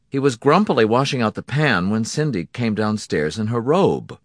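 ordinary delivery

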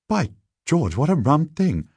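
plain reading voice

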